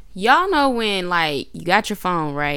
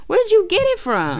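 mock high voice; 14 semitones higher, 4 dB louder than usual. Imitating store clerk question.

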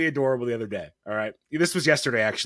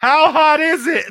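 much higher, loud laughing yell; yelled from bathroom; 13 semitones higher, 8 dB louder than usual. Retold or imitated. Father shouting from the bathroom to his daughter, laughing yell. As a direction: call out through the door, laughing. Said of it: "I'm screaming from the bathroom".

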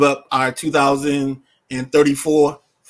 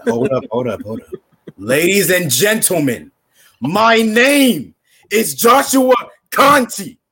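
wrestling manager voice; 12 semitones higher, 3 dB louder, slower than usual. Acted. Wrestling manager intro bit.

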